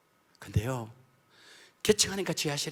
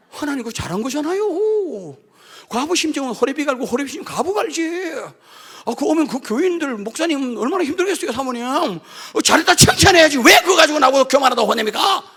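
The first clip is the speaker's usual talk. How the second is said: arguing back; 11 semitones higher, 12 dB louder than usual. Retold or imitated. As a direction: argue back hard with indignation. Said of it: "대들면 대답하신다".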